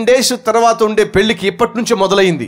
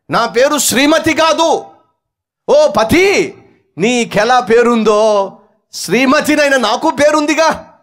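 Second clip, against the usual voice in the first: angry shout; 6 semitones higher than usual. Acted. Wife protesting to husband.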